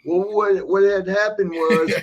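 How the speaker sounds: slow, put-on courtroom excuse; mock lying excuse. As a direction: stall like a liar in front of the judge. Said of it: "which usually means here comes a lie".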